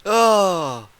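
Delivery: usual speaking voice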